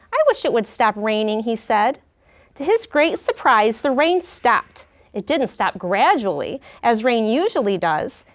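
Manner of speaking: lively, expressive storybook reading; reading to children. Acted. Teacher reading a picture book to her class, lively storytelling. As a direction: bring the story alive for the class.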